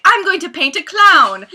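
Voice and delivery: loud, high, eager mock shout; retelling a puppet's loud, high, eager shout; excited puppet. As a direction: burst out like a puppet thrilled by an idea. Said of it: "one of the guys finally gets into it and is like".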